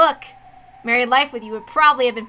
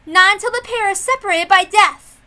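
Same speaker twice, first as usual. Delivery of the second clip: raised, louder insistence; firm declaration; 6 semitones higher, 6 dB louder than usual. Acted. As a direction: lay down the rule with force. Character insists the duel continues.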